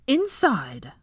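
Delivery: sharp, high barked command; growled order. A narrator's reading. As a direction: snap it at them like a furious teacher. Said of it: "she growled".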